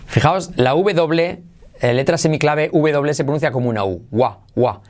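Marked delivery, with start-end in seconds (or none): none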